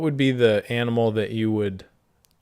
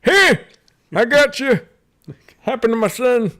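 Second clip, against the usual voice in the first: very high, loud hammed-up old miner; 9 semitones higher, 6 dB louder than usual. Old miner greets the maimed victim, hammed-up squeal.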